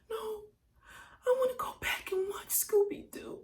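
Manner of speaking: put-on high, loud, tearful little-kid protest; scared child. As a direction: refuse like a frightened child. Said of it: "But you was scared".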